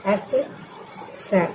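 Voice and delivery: अक्सर drawn out very slowly and softly; slow, soft repetition. Shown as a demonstration. Teacher slowly repeats अक्सर for her students. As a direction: stretch अक्सर out gently for the class. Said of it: "मतलब होता है अक्सर".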